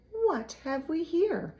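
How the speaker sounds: high, slow, sly rat voice; a rat gloating over a find. A narrator's reading. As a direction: savor the words like a scheming rat. Rat voice in story.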